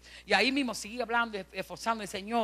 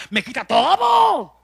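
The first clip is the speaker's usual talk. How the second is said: slow, loud, put-on grumbling voice; mock complainer; 3 semitones higher, 11 dB louder, much slower than usual. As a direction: ham up the scoffer who shuns church. Imita a quien critica la iglesia. (imitando a un quejoso, lento y fuerte)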